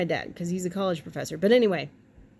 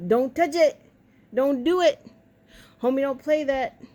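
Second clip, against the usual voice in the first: scolding cat; 5 semitones higher, 5 dB louder than usual. Real, not acted.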